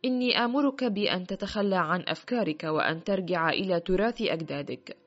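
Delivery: ordinary delivery, nothing marked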